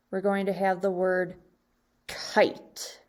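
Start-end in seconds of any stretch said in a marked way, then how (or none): 2.1-3.0 "kite" with a long eye vowel. Shown as a demonstration. Teacher models the long vowel for second graders, "kite" with eye. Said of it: "for the long sound".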